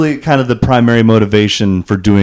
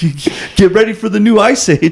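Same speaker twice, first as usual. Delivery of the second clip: bro impression; 10 semitones higher than usual. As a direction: announce the ice age to a stranger. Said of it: "He's like".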